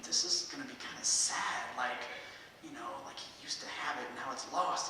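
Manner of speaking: whispered private thought; inner thought, hushed. Retold or imitated. Comic thinking to himself about the old musician, whispered. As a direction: hush it like a worried aside. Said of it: "And I'm thinking".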